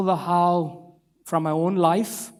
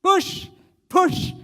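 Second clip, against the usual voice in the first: much higher, loud cheering shout; encouraging shout; 13 semitones higher, 3 dB louder, much slower than usual. Retold or imitated. Father at the car window urging his sons on, shouting encouragingly. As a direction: cheer the boys on with each push. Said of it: "encouragingly shout".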